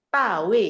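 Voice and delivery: loud corrective "Tawe" with T for D; D becomes T. Shown as a demonstration. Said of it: "jadi T".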